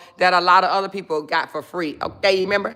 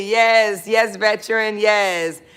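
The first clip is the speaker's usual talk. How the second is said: ordinary delivery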